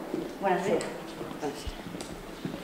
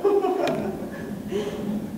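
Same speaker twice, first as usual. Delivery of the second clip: giggle; 7 semitones higher than usual. Acted.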